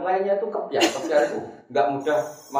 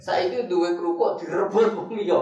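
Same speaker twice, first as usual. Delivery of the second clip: normal talking voice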